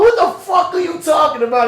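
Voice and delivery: shouted high and loud; acted shout. Pushing Charlotte in the scene, shouting high and loud. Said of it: "I'm like".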